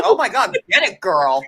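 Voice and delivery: loud, thrilled egging on; excited cheering. Retold or imitated. Viewer loudly cheering on a fight, excited. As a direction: cheer her on from the couch. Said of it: "I was like".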